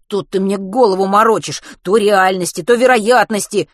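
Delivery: put-on boy exasperated by his friend's riddles; exclaiming. A narrator's reading. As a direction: throw the words back in frustration. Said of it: "воскликнул Матвей".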